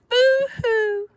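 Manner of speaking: high squeaky boo-hoo; rat crying boo-hoo. A narrator's reading. The scared rat crying, high and squeaky. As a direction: wail it like a startled rat. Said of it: "who cried".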